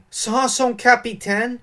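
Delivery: put-on a young sailor hearing he may be made captain; joyful exclamation. A narrator's reading. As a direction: cry it out, eyes shining with joy. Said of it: "s'écria Dante".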